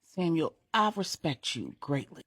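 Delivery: usual speaking voice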